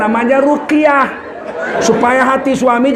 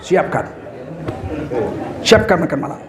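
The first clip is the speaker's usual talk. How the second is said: much lower, softer echo; quiet wife; 7 semitones lower, 7 dB quieter than usual. Acted. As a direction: answer under your breath. Wife's silent reply. (istri menjawab pelan)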